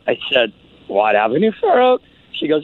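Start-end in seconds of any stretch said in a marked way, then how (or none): 0.9-2.0 lightly exaggerated, questions pitched up; playing it casual to disarm her; deadpan joking with the girl. Comedian on the phone to the girl on the bridge, pitched-up casual.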